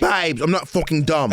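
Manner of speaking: put-on British accent. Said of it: "he's with his British accent".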